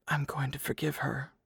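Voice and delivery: barely voiced, very soft whisper; hushed, breathy murmur. A narrator's reading. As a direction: whisper it as a private resolve. Private resolve, spoken under breath.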